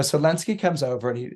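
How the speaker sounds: ordinary talk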